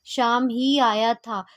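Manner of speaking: slow, deliberate read with ही pressed down; stress on ही. Shown as a demonstration. Teacher slowly reading the example, weight on ही. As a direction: spell it out slowly, leaning on ही. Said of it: "ही में क्या आता है".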